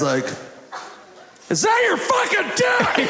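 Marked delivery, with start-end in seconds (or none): 1.5-3.1 furious yell. Retold or imitated. Angry boyfriend confronts him.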